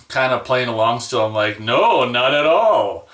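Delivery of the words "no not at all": slow, falsely cheery reply; mock upbeat. Answering the interviewer's accusation, hammed-up cheerful. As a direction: play it fake-cheerful for the interviewer. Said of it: "Like, all upbeat".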